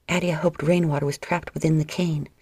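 ordinary delivery

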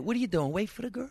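a lightly voiced Italian mom having a fit; scolding mother. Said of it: "My mother would have a fit".

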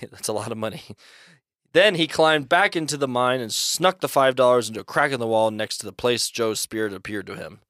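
usual speaking voice

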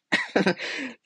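a quick high embarrassed giggle. Real, not acted. Telling viewers about crying in church, a high giggle. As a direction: laugh it off, high and light. Laughing at her own tears.

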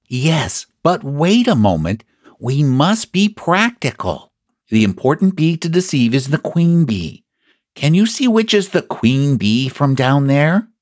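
high-pitched storybook bear, earnest; practical Pooh. Acted. Voices Pooh.

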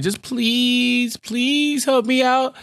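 high, drawn-out begging, hammed up; mock groveling. Man begs Jessica for help, mock groveling. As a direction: plead slowly in a high mock-desperate voice. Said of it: "has come groveling to Jessica".

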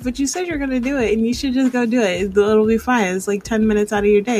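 rapid-fire acted inner nag; nagging inner voice. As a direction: badger yourself like a pestering conscience. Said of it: "hearing that voice in my voice".

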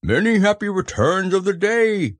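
high, hearty calling voice; Pooh's cheerful high call. A narrator's reading. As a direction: call a greeting across the river. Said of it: "called out Pooh".

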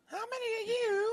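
high rock star screech; [name] voice. Pretend. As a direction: scream it like a stadium rock singer. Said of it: "a moment of chaos as [name]".

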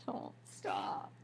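breathy whisper, slow and soft; whispered plea. Retold or imitated. To her dad's loving nickname, a soft whisper. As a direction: whisper it like a tender secret. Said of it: "I'm like".

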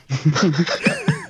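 high breathless laughter; giggling fit. Real, not acted. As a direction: laugh helplessly. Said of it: "We got the giggles".

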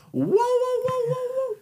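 very high fading whoa; falsetto whoa. Pretend. Host squeals at the twist, very high falsetto. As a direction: squeal it and let it fade.

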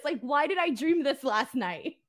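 ordinary talk, nothing marked

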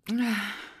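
loud, voiced sigh after a drink; satisfied sigh. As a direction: exhale heartily after draining the glass. Drains a third glass.

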